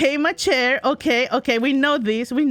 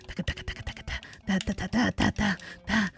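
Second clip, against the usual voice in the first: whispered gallop; 5 semitones lower, 9 dB quieter, faster than usual. Pretend. Imitating galloping noise.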